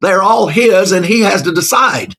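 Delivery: a high, loud shouted "No" that stays loud; forceful rebuttal. Real, not acted. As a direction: preach it down hard at the objector. Preaching rebuttal, rising force.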